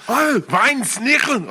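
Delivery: loud, high, slow mock German; hammed-up German gibberish; German accent. Shown as a demonstration. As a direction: shout it in fake German. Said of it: "Every time we do the German one".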